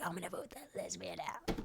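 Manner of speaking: near-whisper, very soft; hushed retold quote. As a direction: drop the quote almost to a whisper. Quoting housemate, voice dropped low.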